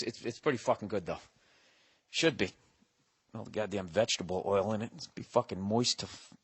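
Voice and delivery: ordinary delivery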